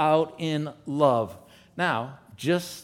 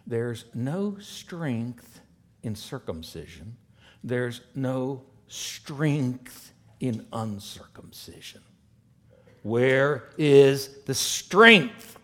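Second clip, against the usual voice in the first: very slow, every word weighed; slow preaching emphasis; much slower than usual. Acted. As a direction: drag it out to drive it home. Emphatic reading of the Greek.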